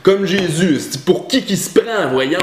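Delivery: lightly mock the people who judge him; mocking critic. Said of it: "vous me critiquez que je me prends pour Jésus".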